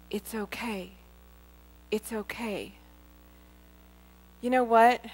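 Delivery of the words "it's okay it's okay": much softer, gentle reassurance; a hint of God's soothing voice; soft comforting voice. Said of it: "this release and this covering".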